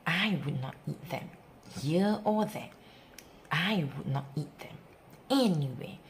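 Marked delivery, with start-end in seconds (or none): none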